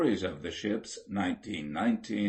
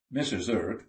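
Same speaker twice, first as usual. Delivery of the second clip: plain reading voice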